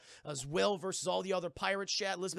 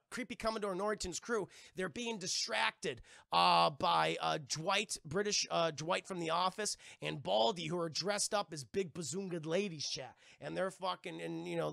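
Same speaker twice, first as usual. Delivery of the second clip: ordinary talk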